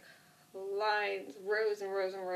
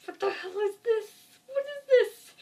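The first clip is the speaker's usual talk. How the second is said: high, squeaky loud incredulity; mock disbelief; 8 dB louder than usual. Imitating the man on the bike, high-pitched mock disbelief. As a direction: shoot way up in hammed-up disbelief. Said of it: "in disbelief".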